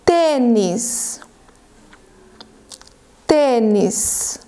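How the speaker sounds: "tênis" drawn out, long pause before repeating; careful, stretched-out dictation word; very slow dictation. Shown as a demonstration. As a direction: dictate it slowly and clearly for pupils. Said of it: "bem devagarzinho".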